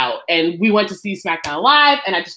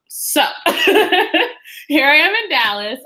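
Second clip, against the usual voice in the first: laughing through the words; 8 semitones higher than usual. Real, not acted. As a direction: chuckle while saying it. Laughing about not finding a job.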